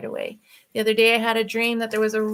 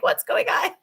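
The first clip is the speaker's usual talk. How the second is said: higher, breathless laughing question; laughing surprise; 12 semitones higher than usual. Real, not acted. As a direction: burst out laughing in disbelief. Surprised by editor's email.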